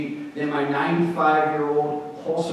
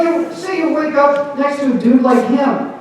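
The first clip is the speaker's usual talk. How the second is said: ordinary talk, nothing marked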